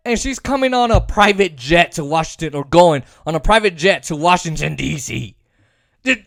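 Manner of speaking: normal talking voice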